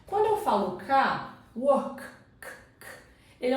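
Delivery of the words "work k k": "work" with a light released K, then the K alone; weak K sound. Shown as a demonstration. Teacher isolating a sound for learners, light final K in "work". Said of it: "não é um som muito forte".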